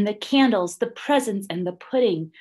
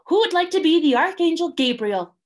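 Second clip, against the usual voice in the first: high and fast, running the show; briskly calling for volunteers; bossy Emu; 10 semitones higher, 6 dB louder, faster than usual. A narrator's reading. Emu runs the auditions.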